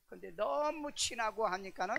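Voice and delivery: ordinary talk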